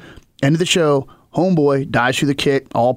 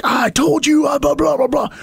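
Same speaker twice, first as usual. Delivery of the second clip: retold onstage yelling, much higher; mock yell; 11 semitones higher than usual. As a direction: yell it in his face, half joking. Paul yells at guy onstage.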